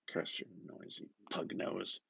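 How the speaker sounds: whispered curse. Acted. Bloom's frustrated muttered thought.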